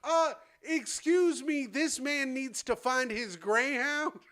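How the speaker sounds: high, slow mock announcement to the room; hammed-up mom voice addressing strangers; announcing loudly. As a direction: imitate a motherly lady announcing to a bus stop. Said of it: "loudly to everyone in the".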